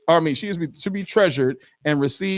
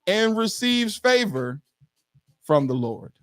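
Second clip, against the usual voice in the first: rising impassioned oratory; 6 semitones higher than usual. Shown as a demonstration. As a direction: lift each phrase like a sermon. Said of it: "my best church voice".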